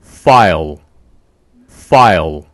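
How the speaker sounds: "File" with an airy English F, low falling tone; English F example. Shown as a demonstration. Teacher giving an example word, "file" carefully modelled with English F. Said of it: "예를 들어볼게요".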